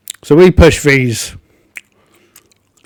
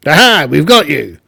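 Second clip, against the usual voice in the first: gleeful goblin, higher and louder; 4 semitones higher, 5 dB louder than usual. Acted. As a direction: gloat like an ambushing goblin. Goblins spring an ambush.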